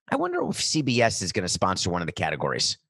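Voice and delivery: usual speaking voice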